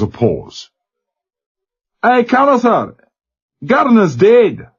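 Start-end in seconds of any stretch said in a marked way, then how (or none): 2.0-4.7 put-on young Glasgow sailor, much higher, thick Glasgow burr; broad Glasgow lad on a crackly line; Glasgow accent. A narrator's reading. Glasgow boy answering the captain over the speaker, Glasgow accent. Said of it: "slurred Glasgow accent".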